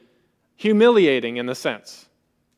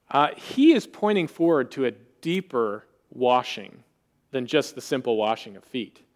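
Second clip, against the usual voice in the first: normal talking voice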